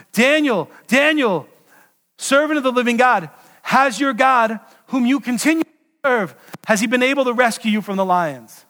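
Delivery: calling out. Acted. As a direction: call out to Daniel in hope. King calling into the den.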